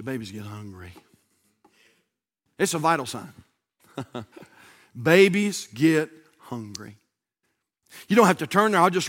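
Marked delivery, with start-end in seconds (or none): 0.0-0.9 low, soft murmur; hushed, understated statement; quiet aside. Real, not acted. Same line said two ways. 5.0-7.0 each word drawn out and set apart; measured, emphatic repeat; slow, deliberate emphasis. Real, not acted. Repeats the line slowly for emphasis.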